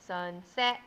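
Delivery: slow syllable-split robot voice. Shown as a demonstration. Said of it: "we can say that slowly like a robot".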